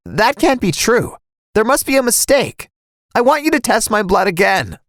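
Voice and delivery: insistent, high-pitched disbelief; stunned denial. Acted. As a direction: protest the verdict in disbelief. Stunned player disputes test result.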